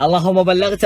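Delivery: usual speaking voice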